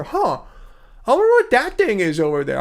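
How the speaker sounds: dim, dopey little bunny; rabbit voice. Pretend. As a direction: play a clueless rabbit wondering aloud. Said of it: "that's my rabbit voice".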